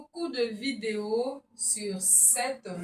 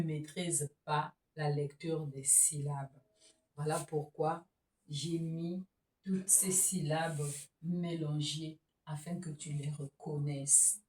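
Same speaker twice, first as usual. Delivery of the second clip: whisper; 5 semitones lower, 8 dB quieter than usual. Real, not acted. Teacher explains quietly.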